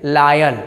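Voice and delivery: लायल with an "aa-y" first vowel, "LAA-yal"; teacher's model of लायल with open "laa"; corrected pronunciation. Shown as a demonstration. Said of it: "क्या पढ़ेंगे?".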